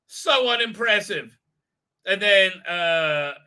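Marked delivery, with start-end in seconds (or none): none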